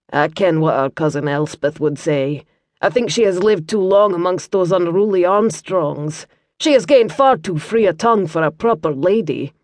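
light Scottish burr, calm and kindly; Scottish monk. Acted. Brother Matthew explains to Liam in a mild Scottish accent. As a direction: explain gently as a Scots monk.

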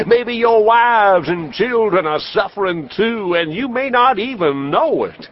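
high, booming medicine show pitch; snake-oil salesman. Acted. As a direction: sell it hard to the crowd like a huckster. Traveling professor sells his elixir.

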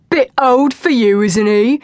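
high, loud mocking taunt. A narrator's reading. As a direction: jeer at her while riding past. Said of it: "taunted Will".

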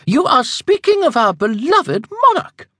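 shocked rising voice. Acted. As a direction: rise sharply in offended shock. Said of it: "Evangeline's voice rose several octaves".

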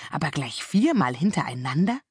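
ordinary delivery

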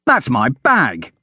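mild, drawn-out shout of protest; slow, understated call across the crowd. A narrator's reading. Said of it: "Hadrian shouted".

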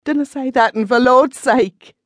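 high, loud, slowed plea cutting her off; panicked yell. A narrator's reading. Frightened man yelling to stop the witch's curse. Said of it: "he yelled".

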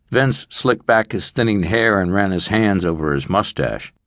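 ordinary delivery

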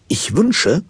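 ordinary talk

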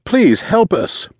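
high, slow, drawn-out pleading; high-pitched cry for help; desperate plea. A narrator's reading. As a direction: beg like a frantic boy.

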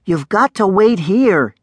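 urgent instruction. A narrator's reading. Darek to the dragonling he is hiding, urgent instruction. Boy warning the hidden dragon.

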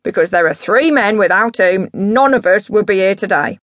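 put-on loud, swooping drunken dad voice; drunk boastful dad. Drunk dad holding forth to a visitor, grandiose. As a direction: declaim it like a grandiose drunk father.

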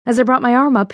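ordinary delivery, nothing marked

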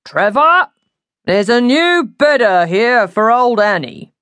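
high, loud call through a doorway; shout. A narrator's reading. Man calls to Trevor about the new bedder, shouting. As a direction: call out to someone inside, stretching the words. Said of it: "leaned in and shouted".

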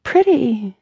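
put-on high, delighted child calling out; a small child shouting with joy; excited little girl. A narrator's reading. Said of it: "A child behind her yelled".

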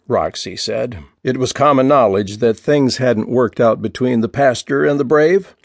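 usual speaking voice

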